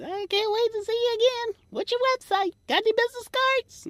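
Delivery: very high, sugary falsetto fan; gushing fan. Retold or imitated. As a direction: gush like an eager fan after the show.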